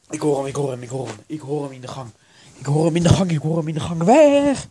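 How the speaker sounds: urgent repetition building to a yell; building from alarm to loud shriek; rising panic. Acted. Panic builds as ghost approaches.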